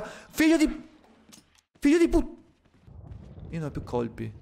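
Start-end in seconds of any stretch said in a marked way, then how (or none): 0.0-2.4 high, louder, breaking off; cursing mid-panic; frantic cursing. Real, not acted. Cursing as zombie attacks.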